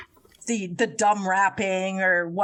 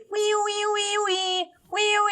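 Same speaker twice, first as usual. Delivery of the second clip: mock high wee; 11 semitones higher than usual. Imitating the singer's vocal.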